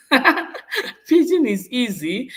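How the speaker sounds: slow laughing drawn-out line; laughing slowly. Real, not acted. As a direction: stretch it out through a laugh.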